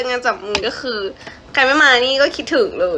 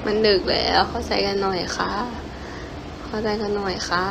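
quiet, hushed plea; soft pleading; 3 semitones lower, 7 dB quieter than usual. Real, not acted. Idol asking fans late at night, soft and hushed. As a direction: beg them softly to understand.

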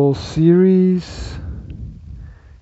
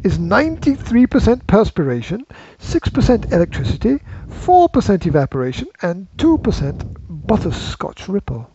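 higher, emphatic showman's delivery; grand, playful character recital; theatrical eccentric inventor; 5 semitones higher than usual. Acted. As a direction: proclaim it to your dear friends. Acting a Willy Wonka quote.

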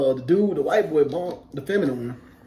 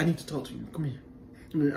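put-on thick African accent; 2 semitones lower, 9 dB quieter, faster than usual. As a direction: imitate an African accent, voice dropped. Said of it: "like, a real thick accent".

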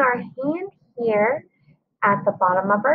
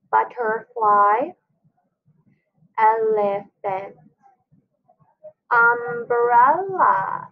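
each word "banana" drawn out slowly, syllable by syllable; slow syllable demo; slower than usual. Shown as a demonstration. Teacher showing kids three-syllable words, slow and deliberate. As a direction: say it slow so the chin drops on every beat. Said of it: "Our first word is".

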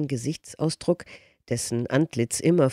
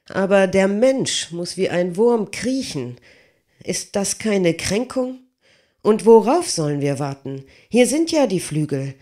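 higher, louder outburst of a frustrated giant; railing smith; 5 semitones higher, 4 dB louder than usual. Acted. Smith railing at Leonardo's mathematics, higher and louder. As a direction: rage against the delay and beg to fly.

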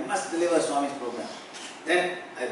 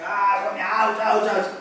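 higher, hammed-up yelps of pain; mock pain; 6 semitones higher, 5 dB louder than usual. Devotee dragged over rocks by Swami, mock pained cries. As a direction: cry ouch like you're playing hurt.

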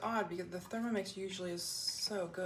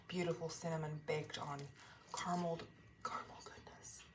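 whispering; 2 semitones lower, 9 dB quieter than usual. Real, not acted. Savoring the baked topping.

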